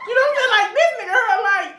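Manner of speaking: high-pitched retold outburst through laughter; laughing disbelief. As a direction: replay the disbelief, cracking up. Laughing, retelling disbelief at cousin.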